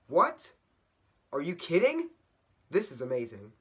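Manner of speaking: high, loud disbelief; acted burst of amazement; astonished. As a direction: blurt it out, thrilled and incredulous. Ted reacts to painted trees.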